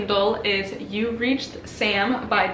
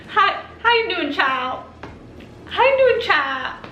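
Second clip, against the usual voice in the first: Southern aunt; 9 semitones higher than usual. Retold or imitated. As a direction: sweetly greet like a Southern aunt. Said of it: "when his aunt calls me child".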